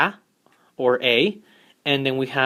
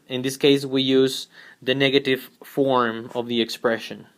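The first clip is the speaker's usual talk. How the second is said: ordinary talk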